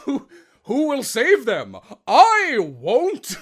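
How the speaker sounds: booming mock-heroic anime hero; cheesy hero voice. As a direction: ham up a budget anime hero. Cheesy hero voice as All Might.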